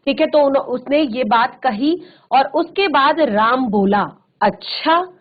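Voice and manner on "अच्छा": a touch of wonder, higher with a rising end; lightly astonished, voice lifting at the end; mild surprise. Acted. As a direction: react with understated surprise. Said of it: "आश्चर्य की भावना पैदा हुई".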